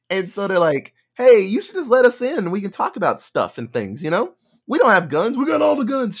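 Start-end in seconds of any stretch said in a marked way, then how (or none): 1.1-6.2 high, louder fake-friendly pitch. Biker talker coaxing the survivors over the radio, high fake friendliness. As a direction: sell them a lie.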